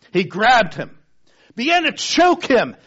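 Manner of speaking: rising intensity, loud as the grab happens; forceful retelling. A narrator's reading. Preacher to congregation, telling the choking scene loudly. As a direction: hit the violence hard in the telling.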